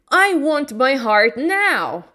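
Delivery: slow, loud, high insistence; insistent demand. Acted. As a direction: demand it firmly. Tin Man demands slowly.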